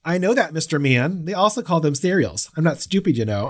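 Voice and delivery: a light Minnesota lilt as Annie; Minnesota accent. Retold or imitated. Host quotes Annie to co-host, mild Minnesota accent. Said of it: "I keep giving her, like, this Minnesota accent".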